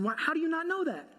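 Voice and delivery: high disbelieving question; incredulous. Retold or imitated. Husband asking wife in disbelief, high incredulous. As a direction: ask it in shocked disbelief.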